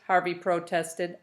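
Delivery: plain reading voice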